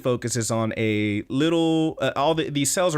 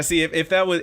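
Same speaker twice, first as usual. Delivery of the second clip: usual speaking voice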